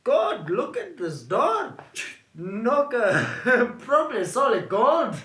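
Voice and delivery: slow, impressed drawl; David gaping at the door; awed nephew. Acted. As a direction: marvel at the knocker.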